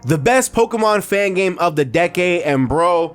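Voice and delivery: loud, high-energy opening pitch; hyped video intro. Acted. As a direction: sell the series finale loudly.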